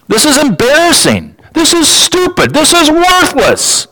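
high, disgusted mock reaction; scornful crowd. Listeners scoffing at the singer, high mocking disdain. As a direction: sneer at the song from way up high.

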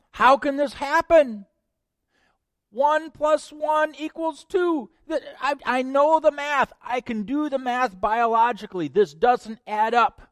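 high, loud, incredulous protest; Mary's disbelief. Acted. Mary questioning the angel, high and disbelieving.

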